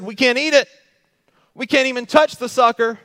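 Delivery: high, loud mock whine of Eve's misquote. Preacher mimics Eve misquoting God, high mocking whine. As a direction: parody her, high and overblown. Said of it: "Eve, she says".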